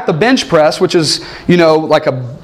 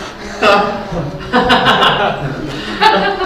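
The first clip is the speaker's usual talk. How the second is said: laugh; 3 dB quieter than usual. Real, not acted. Laughing at punchline.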